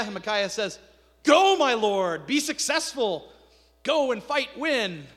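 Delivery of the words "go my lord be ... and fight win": loud, slow, higher sarcastic cheer; mock encouragement. Micaiah mocks the king with fake, loud encouragement. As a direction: cheer him on with fake grandeur. Micaiah mockingly echoes false prophets.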